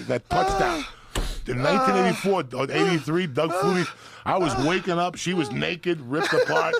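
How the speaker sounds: loud, high, laughing recount; roaring through the wild part of the story; wild excitement. Real, not acted. Teller to friend about the wild night, loud laughing excitement.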